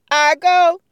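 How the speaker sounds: loud high call. Acted. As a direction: call out for attention. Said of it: "Tyrone raises his hand and says".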